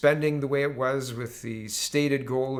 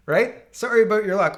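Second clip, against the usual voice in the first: higher, louder smug brush-off; smug dismissive Liberals; 6 semitones higher, 7 dB louder than usual. Acted. Mocking the Ethics Committee in a smug, loud, higher voice. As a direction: play the government waving them off.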